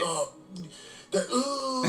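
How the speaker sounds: pained dying groans; wounded soldier gasping. Acted. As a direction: moan like you're dying. Bird playing dead, groaning.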